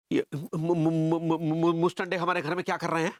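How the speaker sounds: stammering on the first syllable; sputtering. Acted. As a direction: stutter repeatedly before getting the word out. Husband sputters at finding intruders. (हकलाते हुए)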